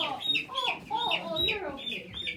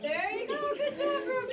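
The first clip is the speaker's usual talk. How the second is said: excited cheering; 12 semitones higher than usual. Real, not acted. Child catches bug, cheering.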